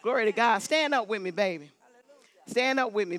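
usual speaking voice

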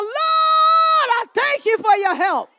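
loud, high shout of thanks; crying out. Shown as a demonstration. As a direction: show the church how to cry out. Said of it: "Somebody ought to cry out".

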